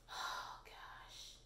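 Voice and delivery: whispered "oh gosh" on a sigh; hushed disbelief; exasperated whisper. Real, not acted. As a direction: breathe it out fed up.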